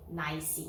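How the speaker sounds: "nice" as "nai-si", an extra vowel on the end; wrong pronunciation. Shown as a demonstration. Teacher rejecting a mistake, "nice" mispronounced with two syllables. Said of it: "não".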